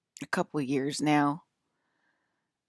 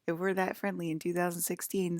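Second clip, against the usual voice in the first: ordinary talk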